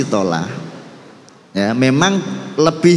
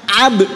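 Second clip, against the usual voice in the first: "ab" punched higher so the b bounces; pressed qalqalah; 6 semitones higher, 3 dB louder than usual. Shown as a demonstration. Teacher shows pressure makes the bounce, punched high "ab". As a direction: press hard on the a of "ab". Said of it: "ketika kita menekan".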